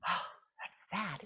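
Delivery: ordinary talk, nothing marked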